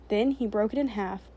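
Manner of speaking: plain reading voice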